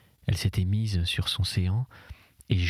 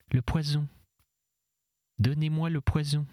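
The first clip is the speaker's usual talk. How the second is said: higher, harsh and insistent; 7 semitones higher than usual. A narrator's reading. Anguished woman repeats her demand for the poison, harsh and high. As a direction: grate out the demand, raw with anguish. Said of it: "sa voix devint âpre pour répéter".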